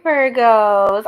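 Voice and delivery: ordinary talk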